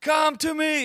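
high-pitched call across distance. Retold or imitated. Dad calling the found child over, hollering. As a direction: holler it to someone far off. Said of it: "And he said".

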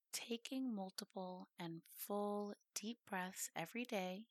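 soft, measured meditation voice with long pauses; guided meditation. Acted.

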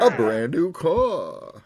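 game show announcer. Acted. Game show prize reveal.

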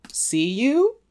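high rising "See you?" with a sly lift on "you"; suggestive. Shown as a demonstration. Podcaster teases the text, "See you?" rising high and suggestive. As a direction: make "See you?" sound flirty, pitch leaping up. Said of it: "Wink, wink".

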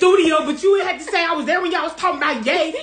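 loud, high indignant imitation. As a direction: protest loudly as the name-dropped singer. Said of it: "[name] be like".

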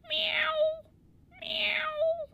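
very high, loud kitten meow; cute meow. Acted. Kitty cat meowing at the hut, very high and cute. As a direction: squeak it like a tiny kitten. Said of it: "such a cute little meow".

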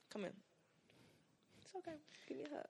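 very soft, tender comforting murmur; hushed consoling. Pretend. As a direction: console a sister in a tiny hushed voice. Mock-consoling a sister with hug.

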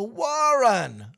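high, loud call back into the bus; retold teammate calling out.

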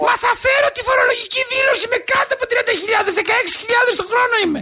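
high-pitched, pleading protest; indignant wail. Acted. Caller protests at being refused fuel.

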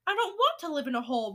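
fast, loud protest; shouting. A narrator's reading. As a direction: shout it in a tantrum. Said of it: "Bruno shouted".

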